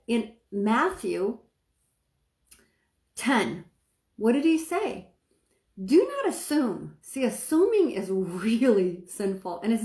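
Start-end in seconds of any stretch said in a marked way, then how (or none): none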